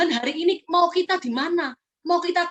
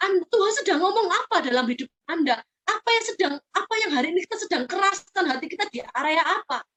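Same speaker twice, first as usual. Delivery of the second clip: usual speaking voice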